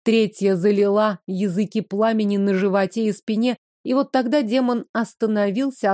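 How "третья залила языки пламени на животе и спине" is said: usual speaking voice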